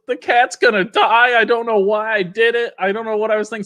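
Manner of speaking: put-on high, wailing little girl's voice, near tears; imitating a bawling child. Retelling the twin's panic to friends, high mock bawling. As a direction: mimic a sensitive kid breaking down. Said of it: "So she starts bawling".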